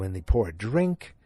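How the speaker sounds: ordinary delivery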